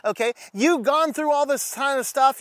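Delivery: ordinary delivery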